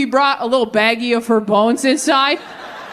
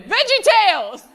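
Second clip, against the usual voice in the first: panicked blurt; 12 semitones higher than usual. Retold or imitated. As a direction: blurt a wild guess in mock panic. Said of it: "panicked and went".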